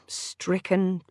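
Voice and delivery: ordinary delivery, nothing marked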